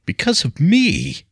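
high, loud, slow cracking question. A narrator's reading. William asking Leah, voice cracking. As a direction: let the voice break on it. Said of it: "His voice cracked at this last statement".

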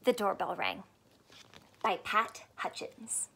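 whispered author's name. Acted. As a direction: breathe the name out softly. Whispered title.

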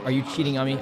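usual speaking voice

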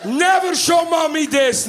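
high, slowed mock-dad warning; urgent warning. Dad warns the kids after the video, shocked. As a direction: warn in shock, in one run.

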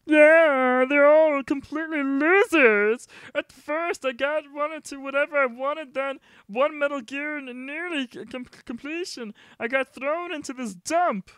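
put-on high, nervous young hostage pleading. Player voices the hostage.

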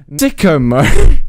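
slow loud yell; shouting. Real, not acted. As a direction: bellow it out slowly. Shouted catchphrase.

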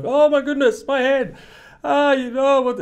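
put-on very high, wailing little-kid voice; mock a hurt toddler bursting into tears; imitated upset child. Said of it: "some of the kids burst into tears".

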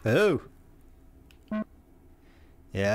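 ordinary talk

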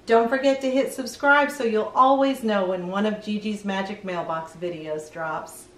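ordinary delivery

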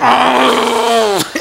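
long, held comic holler; acted-out cry of shock; drawn-out startled yell. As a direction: let out one sustained yell of alarm. Acting out reaction to swinging fists.

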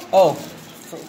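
normal talking voice

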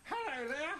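high puppet voice; pig character. Acted. Puppeteer as the pig answering the door, high-voiced.

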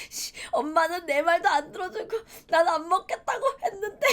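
high, whimpering inner complaint; mock tearful grumbling. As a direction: act out a wronged kid sobbing to themself. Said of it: "혼자 마음속으로". (억울해서 울먹이는 아이 흉내)

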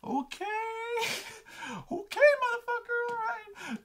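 very high falsetto appeasement; high, comic, uneasy voice; mock placating. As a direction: squeak it as if backing away. Backing away from a raging brother.

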